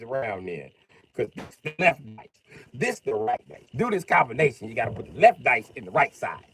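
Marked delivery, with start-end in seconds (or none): none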